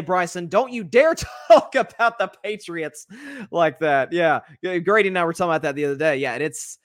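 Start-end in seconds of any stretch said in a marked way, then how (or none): 0.5-4.1 mock-outraged shout, higher and drawn out; hammed-up offended coach, stretching the warning; Josh McDaniels. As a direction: scold slowly with hammed-up indignation. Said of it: "as Josh McDaniels would say".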